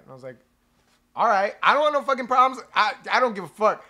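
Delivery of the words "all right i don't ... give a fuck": loud, fast, cursing brush-off; angry retort. Retold or imitated. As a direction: storm off telling him you don't care. Angry walk-away retort in story.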